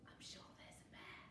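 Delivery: very soft, voiceless secret; whisper. A narrator's reading. Fox to friends about a bear, whispered. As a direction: whisper the warning. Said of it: "she whispered".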